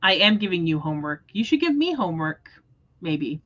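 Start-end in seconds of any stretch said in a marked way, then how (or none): none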